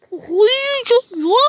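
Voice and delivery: high, loud cracking rant; nearly screaming in frustration; losing it. Real, not acted. Sinirden çıldırmak üzere. (çıldırmış gibi bağırış)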